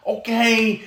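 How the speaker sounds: high, excited okay; excited praise. Shown as a demonstration. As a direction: gush over the dog. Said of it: "here's where the party starts".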